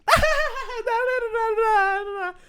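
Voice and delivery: very high, drawn-out mock babble; drunk wife butting in. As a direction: babble over everyone like a hammed-up drunk. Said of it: "And then the wife goes".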